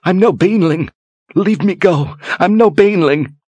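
high, frantic pleading; desperate pleading. Acted. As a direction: beg to be let go.

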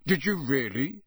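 usual speaking voice

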